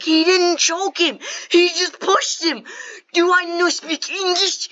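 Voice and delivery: high, loud, slow over-enunciation; exasperated mock shout. Brothers protesting to a teacher who won't understand, exasperated mock shout. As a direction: spell it out loudly as if she can't understand.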